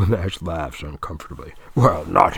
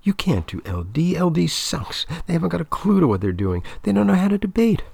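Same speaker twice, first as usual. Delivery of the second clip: pitched up, fast and loud; upset girlfriend, higher, faster and louder; 9 semitones higher, 3 dB louder than usual. Acted. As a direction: scold him, rising fast and loud. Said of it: "voice ... unnecessarily loud".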